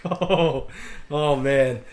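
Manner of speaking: drawn-out oh of realization; dawning dismay. Retold or imitated. Cadet realizing the captain sent the beers, dismayed. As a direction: let it sink in with dread.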